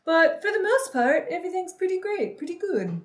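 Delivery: put-on high-pitched, uncertain Minnesotan accent; attempted Minnesotan accent. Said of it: "Is that, is that Minnesota".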